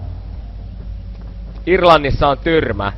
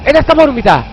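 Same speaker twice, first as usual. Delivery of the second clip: much higher, louder frantic shrieks of no; panicked screaming; 7 dB louder than usual. Real, not acted. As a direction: scream in real terror at the monster. Panicking at game monster.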